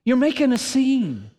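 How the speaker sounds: high, hissed shh then hushed scolding; hushing friends. Acted. Friends telling the blind man to hush, urgent whisper. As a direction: hush him urgently, embarrassed. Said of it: "his friends and those around him said".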